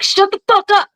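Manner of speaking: sudden high-pitched snap of irritation; annoyed outburst. Retold or imitated. Complaining about rap music, a high irritated snap. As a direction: bark it up high, fed up. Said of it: "I'm like".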